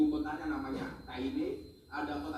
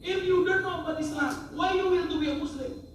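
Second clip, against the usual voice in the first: tinggi dan keras, heran menegur; heran lantang; 11 semitones higher, 7 dB louder than usual. Retold or imitated. Menegur calon mualaf dengan heran. (menegur bule yang tak paham Islam, lantang)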